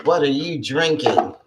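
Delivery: ordinary delivery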